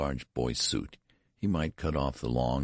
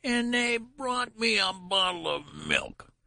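much higher, slow shrill complaint; shrill protest; slower than usual. A narrator's reading. Old man outraged about his milk, shrill. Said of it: "his voice rose to a shrill rise of protest".

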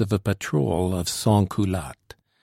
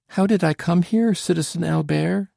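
put-on girlish question; 9 semitones higher than usual. A narrator's reading. Girl voiced in story.

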